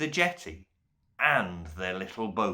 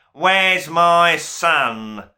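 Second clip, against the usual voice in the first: slow, loud demand for his son; bellowing father; 7 semitones higher, 8 dB louder than usual. A narrator's reading. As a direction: roar it like an outraged duke. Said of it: "a voice bellowed".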